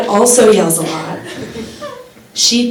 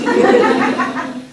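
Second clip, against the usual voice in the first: high giggle; 13 semitones higher, 4 dB quieter than usual. Real, not acted. Laughs at own joke.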